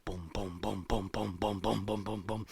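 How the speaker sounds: low, rhythmic booms; deep pounding steps, evenly repeated; imitated approaching booms. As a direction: boom like something big creeping closer. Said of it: "sounds like something big is getting closer".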